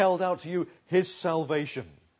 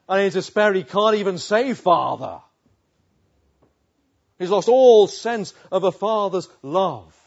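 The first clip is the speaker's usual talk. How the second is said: impassioned preaching; 6 semitones higher, 13 dB louder than usual. Acted. Preacher to church on the cross, impassioned. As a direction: preach it at full force, aching.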